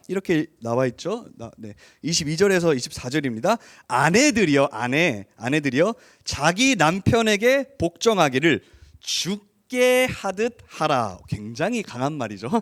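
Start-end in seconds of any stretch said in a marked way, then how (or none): 3.9-11.2 slow, solemn Bible reading; scripture reading. Acted. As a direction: read the verse slowly and weightily. Reads scripture aloud.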